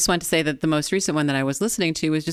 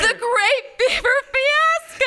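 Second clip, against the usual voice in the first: enthusiastic. Pretend. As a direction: sell it with big enthusiasm. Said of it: "Now with more enthusiasm".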